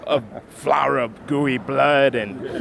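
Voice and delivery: slow, eerie put-on voice; spooky voice. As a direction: drag it out like a horror host. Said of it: "Now he does voices too".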